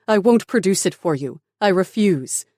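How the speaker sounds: loud, high refusal; defiant cry. A narrator's reading. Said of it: "Tara cried".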